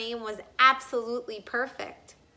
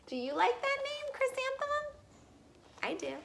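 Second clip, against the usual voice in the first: much higher, quick, soft question; higher, softer teasing question; playful question; 14 semitones higher, 5 dB quieter, faster than usual. Real, not acted. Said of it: "aside to listener, much higher".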